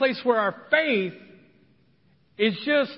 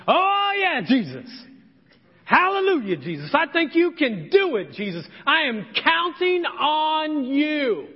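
faked enthusiasm; 5 semitones higher than usual. Pastor acting a father faking faith, hammed-up high praise. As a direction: overdo it like a fake believer. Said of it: "tempted to fake it and be like".

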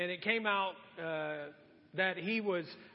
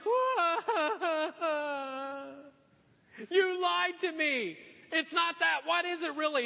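loud, high, drawn-out fake crying; mock wailing complaint; 9 semitones higher, 5 dB louder than usual. Delilah accusing Samson of lying, mock wailing. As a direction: wail the accusation in a hammed-up voice.

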